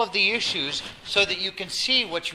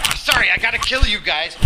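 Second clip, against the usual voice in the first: freaked out; 4 semitones higher, 8 dB louder than usual. Retold or imitated. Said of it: "he's probably freaked out".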